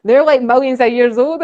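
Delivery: presenter to viewers, excited and folksy; enthusiastic Scottish hook. Shown as a demonstration. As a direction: grab the viewer with a lively Scottish opener. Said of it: "and I'm like".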